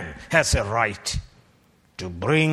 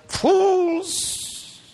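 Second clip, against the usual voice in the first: shouted answer. Acted. Preacher supplies the answer his hearers left unsaid. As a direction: fling out Fools as the answer.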